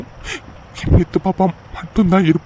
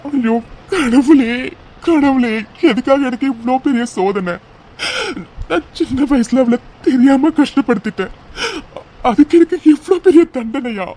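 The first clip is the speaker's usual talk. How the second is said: crying to God; 6 semitones higher than usual. Acted. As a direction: sob it out to the heavens. Cries out to God in guilt.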